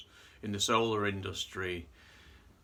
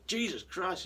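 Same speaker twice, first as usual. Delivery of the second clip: shocked; 9 semitones higher than usual. Retold or imitated. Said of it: "he stood there, says".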